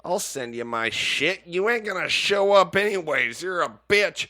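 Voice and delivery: high, loud imitation of a tough guy; mock gangster taunting. Retelling a FaceTime beef to a co-host, mock gangster taunting. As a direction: throw it out like a wannabe gangster calling a bluff. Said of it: "he's kinda being a gangster".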